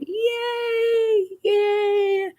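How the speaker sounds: very high squeal of joy; high cheer. Real, not acted. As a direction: celebrate with a squeal. Celebrating a remission.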